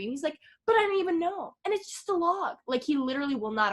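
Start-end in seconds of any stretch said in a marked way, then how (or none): 0.7-2.6 high-pitched defensive repeat; whiny protest. Retold or imitated. Retelling a man who will not apologize, high whiny protest. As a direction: dig in with a high, whiny excuse. Acted Mark refusing to apologize.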